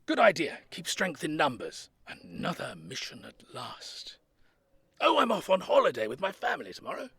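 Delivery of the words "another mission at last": slow, whispered relish; eager whisper. Acted. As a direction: breathe it like a secret treat. Old soldier thrilled, to himself.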